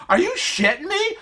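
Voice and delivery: high-pitched disbelief, acted out; incredulous. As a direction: shoot the question way up, astonished. Stunned at what husband revealed.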